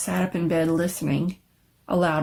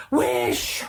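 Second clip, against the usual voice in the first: insistent repeat; 7 semitones lower than usual. A narrator's reading. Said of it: "repeated his wife".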